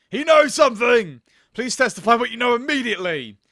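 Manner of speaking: loud, high-pitched courtroom demand; urgent exclamation. Acted. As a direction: burst out and press the witness urgently. Voices urgent demand to witness.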